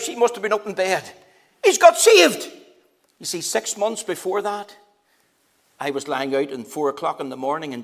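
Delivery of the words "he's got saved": loud high call up to the wife; shouting upstairs. Retold or imitated. Neighbour shouting up to his wife in bed, loud call. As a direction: call it up the stairs, overjoyed. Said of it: "he shouts up to his wife".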